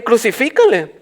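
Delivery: high-pitched imitated crowd cry; half-shouted, acted cry; mock shouting along. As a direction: pretend to yell along with the mob. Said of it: "Tú estarías ahí gritando también".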